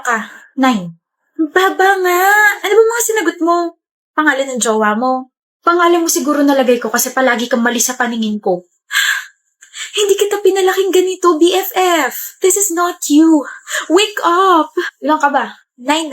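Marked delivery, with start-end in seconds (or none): 1.4-3.6 high-pitched, exasperated disbelief; appalled exclamation. Acted. Exclaims at friend's low score. 9.9-14.7 high-pitched, dramatic telling-off; mock parental scolding. Best friend plays parent over bad grades, mock scolding.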